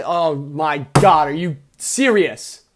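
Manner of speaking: loud, exasperated disbelief; real exasperated exclamation; exasperated outburst. Real, not acted. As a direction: burst out, fed up with the game. Exasperated at the game.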